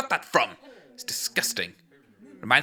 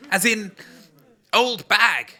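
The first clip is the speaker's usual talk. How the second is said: ordinary talk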